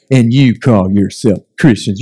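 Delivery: low, slow sour mock complaint; mock indignant whiner. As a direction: drag it out, whining. Repeats the mock complaint slower.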